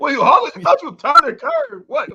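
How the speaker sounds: you can't believe what he said; incredulous. Real, not acted. Scoffing at his co-host's claim of turning the curve.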